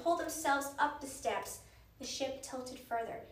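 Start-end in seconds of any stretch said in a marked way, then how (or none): none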